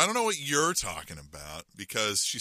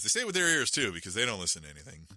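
normal talking voice

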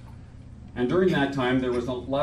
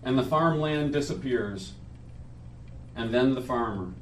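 measured poetry reading at a memorial; solemn reading. Acted. Reads his friend's poem aloud.

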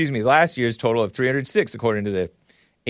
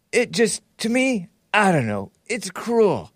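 high mocking old-man voice quoting Biden; Biden impression; 8 semitones higher, slower than usual. Radio host mocking Biden, quoting his interview in a put-on voice. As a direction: lampoon the president in a higher whiny voice. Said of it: "This is my impression of him".

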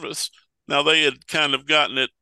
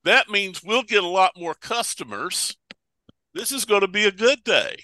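lightly voiced hopeful traders, higher; a touch of acted glee, raised pitch; pleased money changers; 6 semitones higher than usual. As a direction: play mildly eager merchants expecting good business. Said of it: "they're like".

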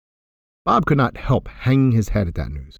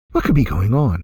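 plain reading voice

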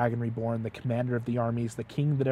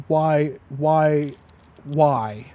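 exasperated plea; 6 dB louder, much slower than usual. Retold or imitated. Exasperated parent in retold moment.

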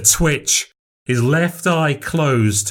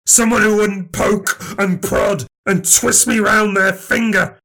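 furious grievance; 9 semitones higher than usual. Acted. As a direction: pour out a lifetime of betrayal. Long furious marital grievance.

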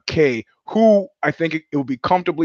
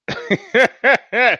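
high burst of laughter; 10 semitones higher, 4 dB louder than usual. Real, not acted. Laughs at own fumbling.

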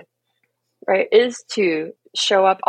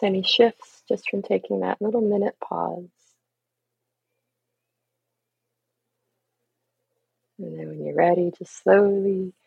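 guided meditation. Acted. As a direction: ease the listener back gently. Leads a short breathing practice.

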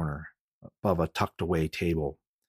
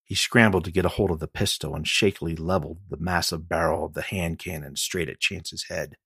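plain reading voice

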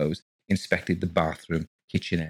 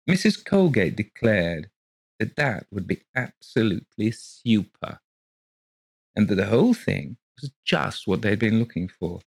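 plain reading voice

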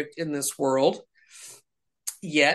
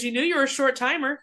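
pastor quoted; 8 semitones higher, faster than usual. Retold or imitated. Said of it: "He goes".

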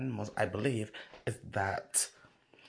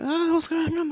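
shocked; 8 dB louder than usual. Retold or imitated. Retold shock at the bang.

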